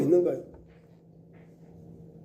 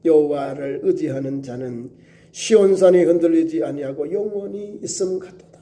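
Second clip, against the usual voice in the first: lower, measured reading of the verse; scripture reading; 5 semitones lower than usual. Shown as a demonstration. Preacher reads the verse to the congregation, measured reading. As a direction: read the psalm out plainly for the congregation. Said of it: "귀한 말씀이 그렇습니다".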